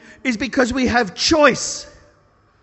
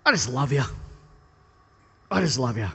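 soft tender repeat; gently telling the angry old man he loves him; 8 semitones lower, 5 dB quieter, faster than usual. Retold or imitated. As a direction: lower and softer, loving him down.

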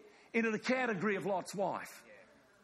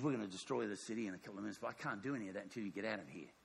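low, soft and very fast; quietly serious warning; 8 semitones lower, 7 dB quieter, much faster than usual. Acted. Angel warning Lot before destroying the city, low and serious. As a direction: press the warning urgently under your voice. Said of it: "I'm really serious".

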